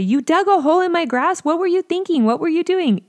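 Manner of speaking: high, loud angry scolding; angry parent. Retold or imitated. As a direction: tear into the kid about the hole. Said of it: "get mad and say".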